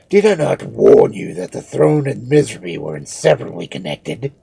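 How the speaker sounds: loud, forceful rhetorical questions; stern mummy turning the blame back; scornful rebuttal. A narrator's reading. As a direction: hammer each question home deliberately. Cheops scornfully rebuts the accusation.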